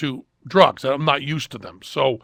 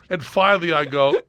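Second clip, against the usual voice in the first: ordinary talk, nothing marked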